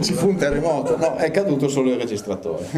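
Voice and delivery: chuckling through the words; amused, half laughing at the mishap; laughing at the crash. Real, not acted. Laughing at the fallen recorder.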